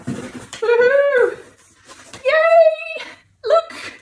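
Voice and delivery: high, squealing whoops of joy; excited cheering. Real, not acted. Celebrating her finished book.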